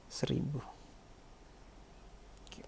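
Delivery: ordinary talk, nothing marked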